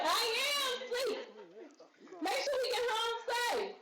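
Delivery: high, thin fake-asleep voice, pushed way up; mock sleepy squeak. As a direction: pretend to be asleep in a tiny high voice.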